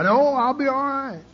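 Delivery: high, breezy mock denial; "No, I'll be all right" as false cheer; mock bravado. Mocking how alcoholics deny help.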